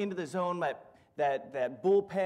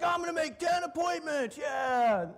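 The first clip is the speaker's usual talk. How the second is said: much higher mock shout; fake pumped; 9 semitones higher than usual. Banker answers the manager, hammed-up pumped. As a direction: shout it with forced enthusiasm. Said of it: "And I'd be like".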